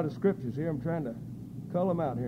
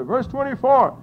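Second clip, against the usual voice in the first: normal talking voice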